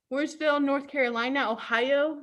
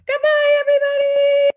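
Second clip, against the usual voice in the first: louder, higher sing-out goodbye; cheery goodbye; 11 semitones higher, 6 dB louder than usual. Real, not acted. Librarian calling goodbye to children on Zoom, bright.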